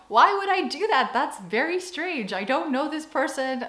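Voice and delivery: high-pitched, baffled self-quote; mock disbelief, pitched well up; incredulous objection. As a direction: protest as if the idea is absurd. Voices own past bafflement at custom.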